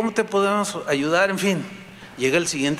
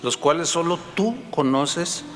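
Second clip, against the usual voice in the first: closing prayer; 4 semitones lower than usual. Acted. Pastor leading the congregation in prayer, humble and earnest. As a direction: pray humbly to God, dropping at each Señor.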